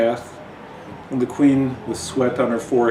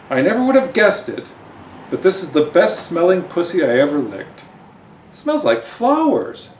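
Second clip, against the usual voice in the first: character voice; 7 semitones higher, 4 dB louder than usual. Acted. Character voiced in comic reading.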